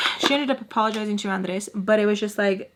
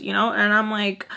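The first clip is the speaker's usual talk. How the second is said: ordinary delivery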